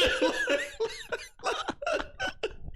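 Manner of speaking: long high-pitched cackling laugh; laughing hard. Real, not acted. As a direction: crack up helplessly at your own story. Cracking up at own retelling.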